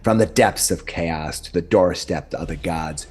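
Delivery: grand fantasy narration over music; epic narration. Acted. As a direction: set the scene like a dark fantasy trailer. Continues epic campaign intro.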